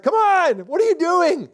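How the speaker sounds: high, loud mocking giant's taunt; Goliath taunting. Goliath jeering at Israel's army, high mocking taunt. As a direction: jeer at the cowering army from the valley. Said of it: "He's like".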